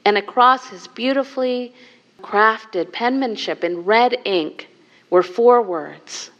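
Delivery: usual speaking voice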